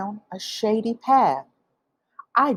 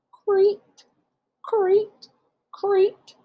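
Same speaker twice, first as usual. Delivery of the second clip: much higher "creak, creak"; cricket chirp; 13 semitones higher, much slower than usual. A narrator's reading.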